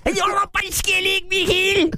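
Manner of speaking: very high, squealing Kerry sing-song; absurdly exaggerated western lilt, shrieked high; far West Cork accent. Showing the accent climbing as you go west, squealed. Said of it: "when you go further west".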